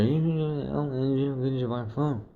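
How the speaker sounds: imitated slurred gibberish, a bit nearer; closer garbled mumble. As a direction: jumble the words so only the last comes through. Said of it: "comes up closer".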